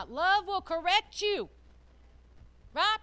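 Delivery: emphatic, ringing sermon; full-force preaching. Real, not acted. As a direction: drive the point home to the congregation.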